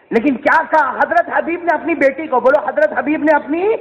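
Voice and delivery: high, driving sermon narration; forceful storyteller-preacher; impassioned preaching. Acted. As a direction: act out the dialogue with rising urgency.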